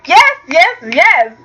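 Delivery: mock cheering yes. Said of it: "I was like".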